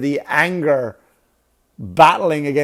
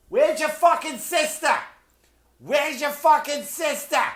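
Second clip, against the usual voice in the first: high, mock-distant yell in a South Boston accent; screechy-high Boston mom, held back in volume; South Boston mother yelling; 12 semitones higher, 3 dB quieter than usual. Boston mother calling her child in Target, South Boston accent.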